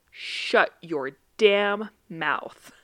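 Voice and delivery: very slow, word-by-word imitation of a toddler; slow, serious child. Three-year-old nephew telling off his uncle, slow and serious. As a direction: space out every word in dead earnest. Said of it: "he was so serious 'cause he slowed it down".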